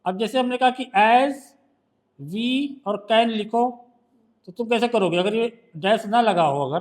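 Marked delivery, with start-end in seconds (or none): 2.2-3.8 slow dictation. Shown as a demonstration. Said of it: "dictates phrase slowly".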